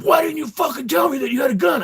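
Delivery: shouting high and loud, right in the ear; angry shout. Retold or imitated. Cop screaming into the arrested man's ear, loud and high. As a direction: yell it like a furious cop. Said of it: "and he's like".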